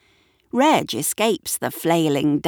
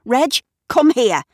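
raised, higher-pitched command; demanding; 7 semitones higher, 6 dB louder than usual. A narrator's reading. Shirley calls Reg from the animal fight, demanding. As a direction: order the dog over loudly. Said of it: "demands Shirley".

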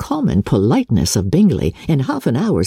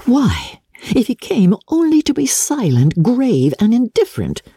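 put-on a touch higher, vexed young woman; lightly voiced Elizabeth; 9 semitones higher than usual. A narrator's reading. Elizabeth puzzling over Darcy's silence, lightly higher and vexed. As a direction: lift the voice a little for a puzzled heroine.

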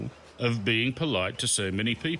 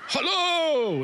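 high, loud mock-yelled hello; imitated yell; 4 dB louder than usual. Strangers yelling at the foreigner, high mock yell. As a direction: holler it like a stranger in the street. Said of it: "Strangers yelled".

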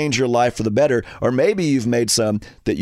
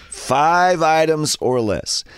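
slow, higher, emphatic repeat; reading a sign; 4 semitones higher, much slower than usual. Acted. Shopper repeats the lane sign, slow and emphatic. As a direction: spell out the rule with stretched words. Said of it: "and it says".

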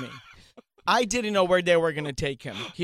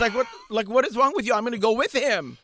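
indignant; 5 semitones higher, 6 dB louder than usual. Retold or imitated. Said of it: "I'm like".

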